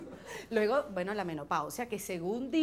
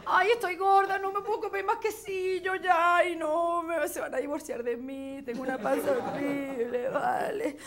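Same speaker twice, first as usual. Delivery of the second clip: mock postpartum panic; 5 semitones higher, 7 dB louder than usual.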